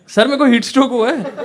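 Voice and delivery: high, loud mock-pitiful plea of a faking boy; mock sick plea. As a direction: play it up as a sickly schoolboy begging sir. Faking heatstroke to PT sir. (ऊँची, ज़ोरदार नकली बीमारी वाली गुहार)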